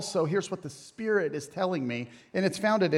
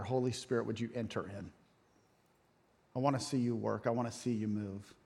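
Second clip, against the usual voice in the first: hushed prayer; 6 semitones lower, 10 dB quieter than usual. Retold or imitated. Retelling Travis's earlier prayer.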